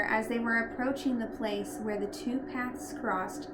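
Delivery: ordinary delivery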